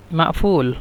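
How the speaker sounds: মাফুল with a glottal catch after "ma"; Egyptian pronunciation. Shown as a demonstration. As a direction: swap the q in মাফুল for a hamza. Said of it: "উচ্চারণ মিশরের ক্ষেত্রে".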